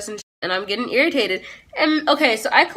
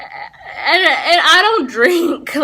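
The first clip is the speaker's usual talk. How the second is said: higher, louder, slow mock whine; whiny plea; 6 semitones higher, 5 dB louder, much slower than usual. Teen pleads with police she doesn't drink, in a mock whine. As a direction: drag it out like a wounded teen.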